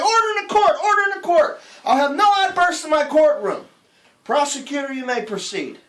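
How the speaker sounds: high, loud judge yelling for order; shouting judge. Acted. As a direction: roar it over the courtroom noise.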